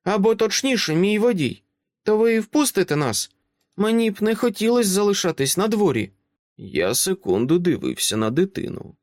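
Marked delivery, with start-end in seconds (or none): 0.0-6.1 put-on high child voice, calm and grown-up; a small girl who talks like an adult; composed little girl. Child asks Dresden to let them in, composed and high.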